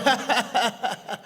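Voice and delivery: imitated attacker's laugh; high, repeated mock laughing. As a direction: laugh tauntingly like the man just punched. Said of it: "he just started going".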